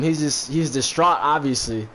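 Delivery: ordinary talk, nothing marked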